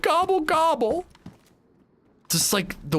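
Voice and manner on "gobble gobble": very high, slow cartoon gobble; mock turkey gobble. Laura Palmer gobbling to her boyfriend, very high mock gobble. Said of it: "She's like".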